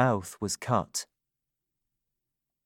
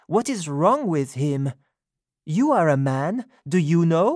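Sophie's voice; 5 semitones higher than usual. A narrator's reading. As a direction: speak up as her, indignant. Sophie describing Roper.

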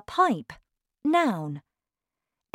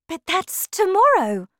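high, loud exclamation of surprise; alarmed cry; 12 semitones higher, 7 dB louder than usual. A narrator's reading. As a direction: cry out in sudden realisation. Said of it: "cried Missus Bucket".

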